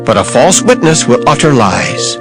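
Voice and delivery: ordinary delivery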